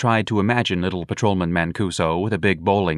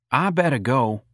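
mildly apologetic, higher-pitched voice; 6 semitones higher than usual. A narrator's reading. Patrolman excusing himself from the family quarrel, apologetic. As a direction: excuse yourself meekly, pitched a little high. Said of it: "Patrolman Mancuso said apologetically".

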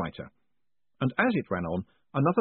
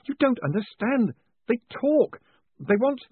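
high, urgent, panicky voice; frantic insistence; 14 semitones higher, 3 dB louder than usual. A narrator's reading. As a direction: plead to be believed, breaking off unfinished. Panicked man insisting to golem.

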